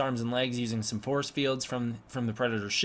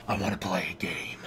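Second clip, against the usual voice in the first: low, quiet, goofy computer impression; 8 semitones lower, 4 dB quieter than usual. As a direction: imitate a computer's voice, pitched well down. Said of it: "this goofy computer voice".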